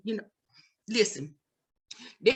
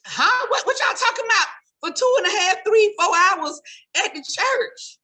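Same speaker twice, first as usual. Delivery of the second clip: incredulous reply; 5 semitones higher than usual. Retold or imitated. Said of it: "I'm like".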